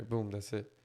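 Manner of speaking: usual speaking voice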